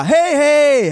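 loud, high hey-hey; calling for attention. Retold or imitated. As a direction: call out to an inattentive crowd. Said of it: "Och jag bara".

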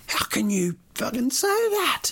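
an indignant shout back at the taxi driver; passionate outrage. Retold or imitated. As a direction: hurl the question at him, worked up. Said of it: "I was so passionate".

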